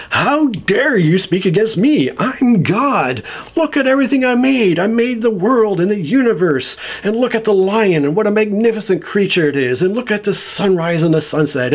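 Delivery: high, indignant mock tirade; mock divine outrage; pissed-off God. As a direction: rant like an offended almighty, hammed-up. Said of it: "God is pissed".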